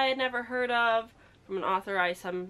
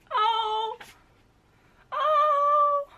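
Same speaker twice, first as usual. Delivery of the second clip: high excited squeal; 8 dB louder than usual. Real, not acted. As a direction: squeal with delight. Excited discovery.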